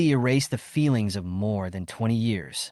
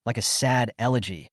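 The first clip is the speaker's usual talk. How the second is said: plain reading voice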